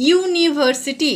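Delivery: ইউনিভার্সিটি slowly, with a clear yoo at the start. Shown as a demonstration. Teacher slowly pronouncing a word for children. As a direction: stretch out ইউনিভার্সিটি syllable by syllable. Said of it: "অ্যানিভার্সিটি আমরা বলছি না".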